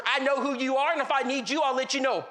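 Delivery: lightly acted cranky dismissal; mock cranky man. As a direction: imitate a grumpy neighbor cutting in. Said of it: "I've been told Ed is cranky".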